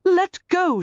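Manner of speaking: gasped. A narrator's reading. Garrett trapped by the cane, gasping. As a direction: gasp it out, trapped. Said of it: "she gasped".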